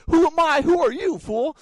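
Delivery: very high, loud, cocky yell; indignant shout. Retold or imitated. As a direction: shout your own name like a champion. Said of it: "that's, like, our joke".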